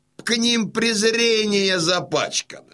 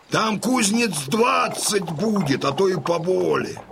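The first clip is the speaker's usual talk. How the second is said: normal talking voice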